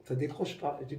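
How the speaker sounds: normal talking voice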